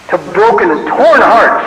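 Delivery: ordinary talk, nothing marked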